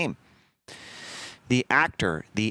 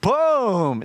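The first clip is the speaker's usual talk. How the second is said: shotgun boom; 13 semitones higher, 7 dB louder than usual. Retold or imitated. Imitates the shotgun blast.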